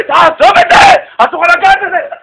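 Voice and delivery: high, loud scolding with drawn-out words; shouting at someone. Retold or imitated. Whole synagogue shouting at a visitor to drop it, yelled. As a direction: imitate an outraged crowd yelling a prohibition. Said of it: "מתחיל לצעוק עליי".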